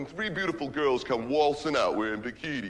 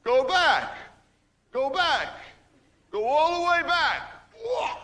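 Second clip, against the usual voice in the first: calling out; 12 semitones higher, slower than usual. Acted. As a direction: shout him back to catch it. Directing a ball game outside.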